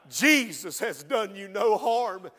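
ordinary talk, nothing marked

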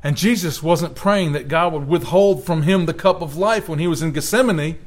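ordinary talk

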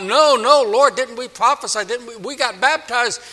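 high, pleading mock panic; frantic acted protest; crying out. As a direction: plead your case desperately, pitch way up. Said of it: "they're gonna be crying out".

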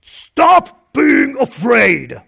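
exaggerated harsh order, spelled out slowly; mock harsh shout. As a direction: bark it like an impatient parent. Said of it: "in a".

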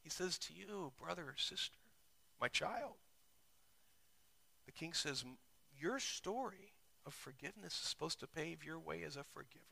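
high, soft, tender preaching. Acted. Preacher softly voices the king to his children. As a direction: speak to them like a loving father. Quiet tender section of sermon.

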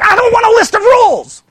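high, loud acted outburst; hammed-up exploding frustration; exasperated shout. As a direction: yell it in fed-up protest. Acts out exasperated person rejecting rules.

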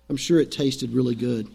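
usual speaking voice

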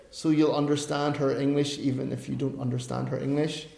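ordinary delivery

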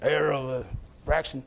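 low-pitched man calling out; imitating Big Joe.

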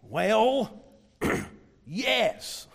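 grudging, hesitant yes; reluctant yes. Pretend. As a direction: drag out a reluctant well, then yes. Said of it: "they would have to say".

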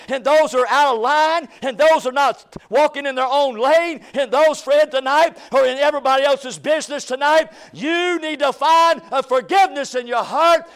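loud, driving preaching. Acted. Pastor praying for revival over the church, fervent preaching. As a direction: hammer each line home to the church.

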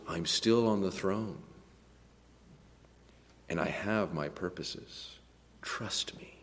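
hushed, low and steady; quiet reassurance. Acted. God answering troubled believers, quietly reassuring. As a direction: settle them softly, dropping to a near-whisper.

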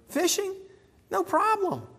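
casual high aside; light and high. Real, not acted. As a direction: brush it off lightly. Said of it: "preacher lifts tone".

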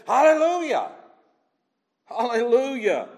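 high, loud shout of acclamation; crying out in praise. Acted. Preacher voicing the elders' worship, crying out. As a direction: proclaim it high, full-voiced. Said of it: "And here it comes again".

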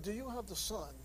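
quiet earnest question. Retold or imitated. Preacher retelling asking a man on the street, softly. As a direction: ask it gently, close in.

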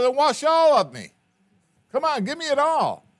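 loud, high pleading cry; Peter's eager shout. Acted. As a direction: beg to be washed all over. Peter begging to be washed.

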